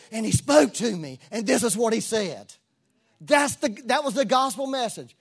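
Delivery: impassioned pulpit delivery; preaching at full force. Acted. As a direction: lift the congregation with it.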